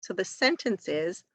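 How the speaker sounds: normal talking voice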